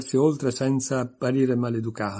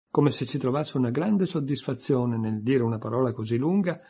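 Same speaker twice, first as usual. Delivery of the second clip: usual speaking voice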